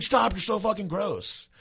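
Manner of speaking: high mock-disgusted whine; disgusted friend. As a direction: play the grossed-out buddy, high and drawn. Friend disgusted, pushing him off.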